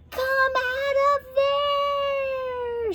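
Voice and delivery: high, drawn-out witch yell. A narrator's reading. Witch Beryl yells at the locked door. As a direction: shriek it through the door. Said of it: "she yelled".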